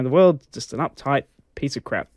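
normal talking voice

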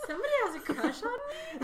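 laughing through the words; high, cracking with laughter. Real, not acted. Laughing at a funny reading.

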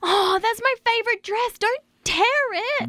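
high fast woman's voice; high girly voice. Acted. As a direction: squeak it out like a girl. Wendy played in high voice.

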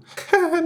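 short high nervous laugh. Acted. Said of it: "Nervöses Lachen".

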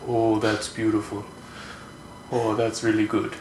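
soft East Coast father-in-law; East Coast accent. Retold or imitated. Imitating father-in-law after a Giants win, soft East Coast accent. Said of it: "he got his accent, too".

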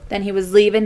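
ordinary talk, nothing marked

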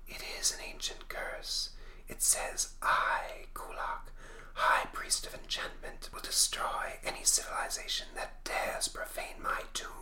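slow, hushed spectral whisper; voiceless, eerie whisper; ghostly whisper. Acted. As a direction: whisper it like a ghost reading a curse. Spectre reads the curse aloud.

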